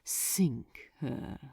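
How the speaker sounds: unvoiced savoring whisper; whispered relish. A narrator's reading. Archdemon repeating the order to Crowley, relishing whisper. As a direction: whisper it like tasting fine wine. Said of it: "repeated Asmodeus, luxuriating at the words".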